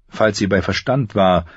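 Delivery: normal talking voice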